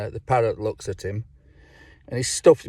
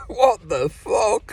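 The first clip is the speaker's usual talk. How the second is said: laughing disbelief; 10 semitones higher, much slower than usual. Retold or imitated. Laughs at retold remark.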